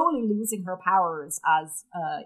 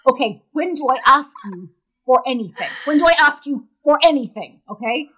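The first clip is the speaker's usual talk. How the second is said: indignant, raised-voice appeal; mildly heated, higher and louder reproach; exasperated pleading; 3 semitones higher, 7 dB louder than usual. Acted. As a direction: plead your case, put out. Acted quarrel between Peregrine and spider.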